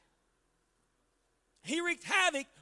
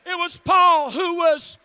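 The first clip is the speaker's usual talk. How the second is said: full-force preaching; 2 semitones higher, 11 dB louder than usual. Acted. Pastor lifting up his congregation, full-force preaching. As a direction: build it up and press every line home.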